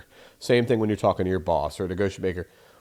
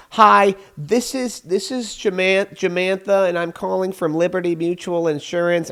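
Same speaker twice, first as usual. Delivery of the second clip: mock chirpy telemarketer; 8 semitones higher, 4 dB louder than usual. Caller announcing name and company to receptionist, mock chirpy telemarketer. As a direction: send up an insurance caller's canned introduction. Mocks caller introducing herself in full.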